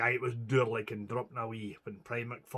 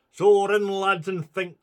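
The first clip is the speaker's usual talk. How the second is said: loud and higher, urging them to run; urgent call; 7 semitones higher, 8 dB louder than usual. Acted. Wee Willie urging the two lads to run, loud and high. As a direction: push the lads off into the dark with a cry.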